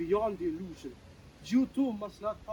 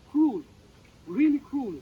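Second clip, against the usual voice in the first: pained outcry; 5 semitones higher, 8 dB louder than usual. Acted. Character protests the word illusion.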